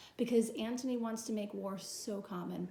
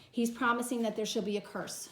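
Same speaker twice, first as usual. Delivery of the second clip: ordinary delivery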